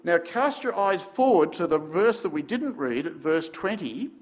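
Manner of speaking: ordinary talk, nothing marked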